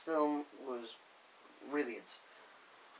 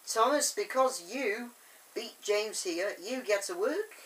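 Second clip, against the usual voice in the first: lightly voiced controller, raised pitch; controller's announcement; 5 semitones higher, 3 dB louder than usual. Mister Percival rewarding Thomas for the race, lightly acted announcement. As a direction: announce a reward, a bit higher and louder.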